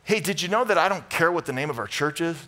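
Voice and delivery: normal talking voice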